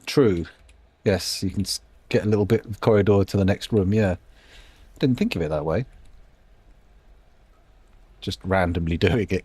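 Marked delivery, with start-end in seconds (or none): none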